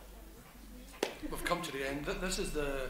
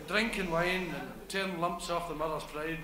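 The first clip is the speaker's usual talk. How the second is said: ordinary delivery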